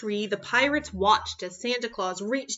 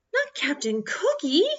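put-on firm child refusal; 5 semitones higher than usual. Child refusing mother firmly.

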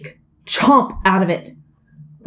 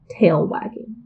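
plain reading voice

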